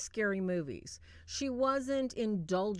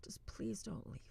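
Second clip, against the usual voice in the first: very soft, whispered begging; imitated tearful plea; 13 dB quieter than usual. Frightened girl begging her boyfriend to stay, hushed tearful plea. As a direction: plead weakly, acting near tears. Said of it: "she starts crying".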